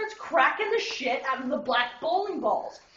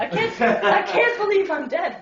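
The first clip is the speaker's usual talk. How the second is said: tipsy nun voice; 7 semitones higher, 7 dB louder than usual. Shown as a demonstration. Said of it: "I can't do the accents 'cause I'm drunk".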